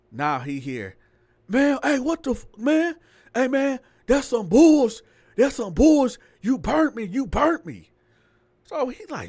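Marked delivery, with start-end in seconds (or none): 1.5-7.9 yelling argument. Retold or imitated. Heard arguing outside at night.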